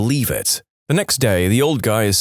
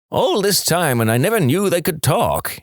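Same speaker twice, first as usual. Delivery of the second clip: amazed farmer; 7 semitones higher than usual. Acted. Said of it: "he says".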